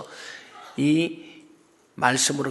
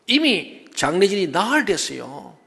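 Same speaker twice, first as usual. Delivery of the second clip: usual speaking voice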